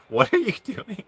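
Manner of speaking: high, startled teacher's question; a hint of a teacher catching him out; surprised teacher. Teacher walking in on the class, mock startled.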